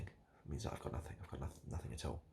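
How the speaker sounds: usual speaking voice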